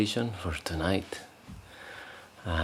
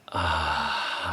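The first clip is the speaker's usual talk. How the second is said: long, falling sigh out; audible sigh; 2 semitones lower than usual. Shown as a demonstration. Teacher showing the group how to sigh out, audible relaxing sigh. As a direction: let the breath out on a relaxed "ah". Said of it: "making some noise helps to relax".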